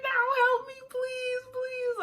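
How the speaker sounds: high-pitched mock wail; crying and screaming, imitated. Labouring woman begging everybody for help, imitated crying. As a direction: act out a tearful, desperate plea. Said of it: "crying and screaming".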